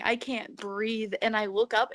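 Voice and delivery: usual speaking voice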